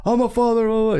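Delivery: high mock protest of an outraged traveler; spazzing out. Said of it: "He starts spazzing out".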